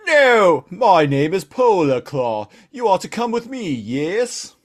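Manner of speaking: high, slow, grand introduction; Polar Claw character voice. Acted. Polar Claw introducing himself to Megatron, high put-on voice. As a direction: announce yourself in a high, drawn-out voice.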